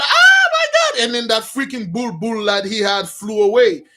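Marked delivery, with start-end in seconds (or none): 0.0-1.0 sudden high yelp of fright, much higher and louder; shrieked in surprise; startled cry. Retold or imitated. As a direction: gasp it out like a girl caught off guard. Girl startled as door kicked in.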